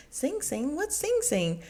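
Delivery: high, puzzled question; baffled, looking around the table; confused. Retold or imitated. The women at dinner, high and baffled.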